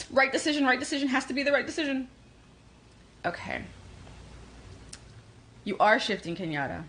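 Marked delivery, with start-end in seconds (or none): 0.1-2.1 higher, frantic fretting after a gasp; mock panic. Women spinning over the right decision, mock panic. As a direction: fret in a rushed, raised voice.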